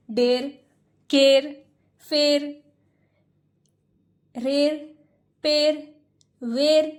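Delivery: डेर, केर, फेर read the wrong way, each one punched loud; wrong reading demo. Shown as a demonstration. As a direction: show the wrong way these words get read. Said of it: "लेकिन आप गलत हो जाएंगे". (गलत उच्चारण का उदाहरण, ज़ोर से)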